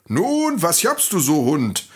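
lightly voiced donkey, high lively question; curious donkey. Donkey asks a panting dog on the road, curious. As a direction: raise the pitch on a brisk, nosy donkey question.

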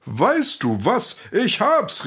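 slow lead-in, then a high burst; excited call. Acted. Donkey has an idea for the dog, calling out excitedly. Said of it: "rief der Esel".

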